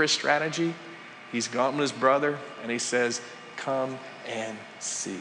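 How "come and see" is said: slow, much softer come and see; a quiet, slow come and see; soft invitation. Retold or imitated. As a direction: invite him in gently and slowly. Andrew inviting his brother.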